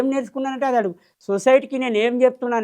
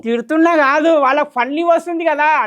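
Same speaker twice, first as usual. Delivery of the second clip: higher and louder, protesting; heated defence; 7 semitones higher, 6 dB louder than usual. Real, not acted. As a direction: push back hard, voice up. Defends his swear dialogue.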